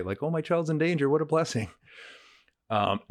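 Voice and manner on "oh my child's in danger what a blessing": acted parent's exclamation, pitched well up; imagined parent. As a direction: voice a parent's high-pitched, hypothetical outburst. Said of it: "as a parent, that's a hard thing to say".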